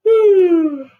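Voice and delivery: loud high yawning hum; long drowsy hum; sleepy yawn hum. Shown as a demonstration. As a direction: yawn it out like you're half asleep. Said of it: "cheio de sono".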